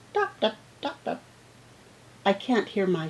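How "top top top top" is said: high, slow top, top, top, top, like heavy shoe steps; father's footsteps. Shown as a demonstration. Reading about a father's shoes, high and evenly paced. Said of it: "My father walks slowly and his shoes go".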